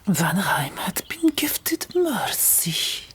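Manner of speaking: ordinary delivery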